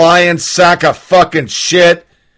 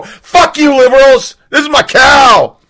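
higher, defiant shout at the liberals; mock-defiant rancher shout; defiant yell; 8 semitones higher than usual. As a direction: yell it proudly from the front yard. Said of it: "Put a pan right there and go".

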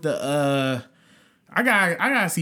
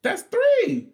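exasperated; 3 dB louder than usual. Real, not acted. Heated argument over album hits.

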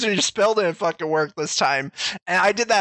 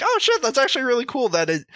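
excited quote; 7 semitones higher, faster than usual. Retold or imitated. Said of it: "I was like".

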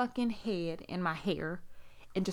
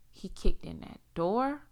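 ordinary talk

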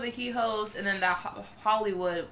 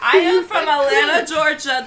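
loud, higher, emphatic Southern accent; peach Southern accent; 7 semitones higher, 11 dB louder than usual. Real, not acted. Guest defending her Atlanta roots, loud Southern accent. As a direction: protest proudly in a Georgia Southern accent. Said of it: "That is my peach Southern accent".

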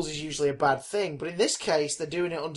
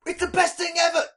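mock gushing fan; 12 semitones higher, 3 dB louder than usual. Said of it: "It's like".

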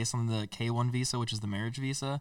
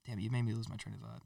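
normal talking voice

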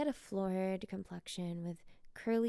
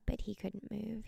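plain reading voice

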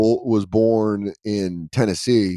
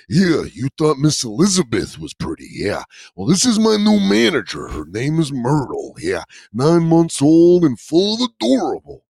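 higher hyped wrestler promo; Macho Man voice; 5 semitones higher than usual. Retold or imitated. Owner introducing dog as manager, Macho Man promo. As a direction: sell the new manager like Randy Savage. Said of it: "Macho Man".

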